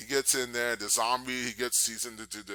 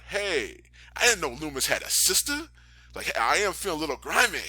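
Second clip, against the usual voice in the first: higher, louder cocky come-on; hammed-up lecherous line; sleazy flirt; 5 semitones higher, 5 dB louder than usual. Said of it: "he's like".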